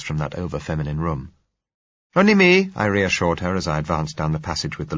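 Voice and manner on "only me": much higher, louder call. A narrator's reading. Narrator calling to the nervous woman, raised call. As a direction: call down the passage to reassure her.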